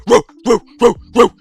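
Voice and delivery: mock dog bark woo woo; imitated barking. Fighter crying and barking at the camera. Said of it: "and he's going".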